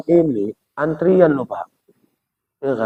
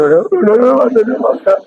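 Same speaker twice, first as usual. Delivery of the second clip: insistent repeated sit; 7 semitones higher than usual. Retold or imitated.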